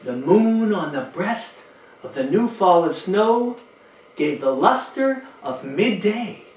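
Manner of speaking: gentle read-aloud storytelling voice; lilting rhymed read-aloud; storybook reading. Acted. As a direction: read it like bedtime to a room of kids.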